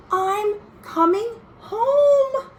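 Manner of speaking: high, slow, joyful cry of news; a child overjoyed at going home. A narrator's reading. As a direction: savor the happy news, slowly and high. Letter brings news of going home.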